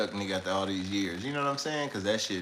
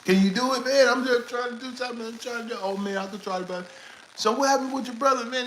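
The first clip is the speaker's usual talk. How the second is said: higher, pressing voice of the caller; pushy caller. Retold or imitated. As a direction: lean on him to make it happen.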